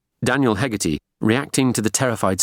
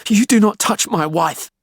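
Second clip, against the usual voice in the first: high, protective shout; outraged husband's raised-voice threat; angry yell; 14 semitones higher, 5 dB louder than usual. A narrator's reading. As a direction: yell a furious warning. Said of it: "he yelled at him".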